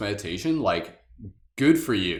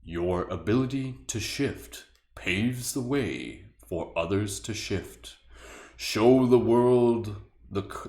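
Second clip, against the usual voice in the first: slow, drawn-out dragon voice; slower than usual. Pretend. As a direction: read it slowly like a wise old dragon. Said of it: "I'll read it in my dragon voice".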